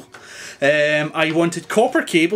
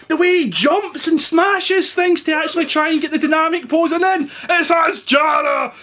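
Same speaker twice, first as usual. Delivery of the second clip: put-on Australian accent; 9 semitones higher than usual. Vlogger urges the girls to go look at Jara, in a hammed-up Australian accent. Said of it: "that's my Australian accent".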